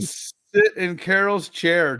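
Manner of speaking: ordinary delivery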